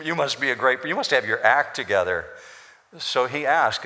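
usual speaking voice

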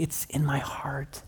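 whispered confession. Shown as a demonstration. Preacher confessing inner road rage, in a whisper. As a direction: whisper it like a secret. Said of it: "not using inappropriate language outwardly".

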